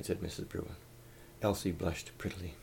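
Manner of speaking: plain reading voice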